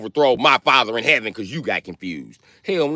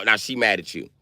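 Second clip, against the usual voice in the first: normal talking voice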